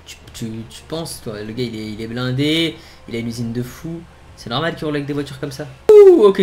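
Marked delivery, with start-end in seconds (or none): 5.9-6.4 surprised. Real, not acted. Sudden switch back to normal view.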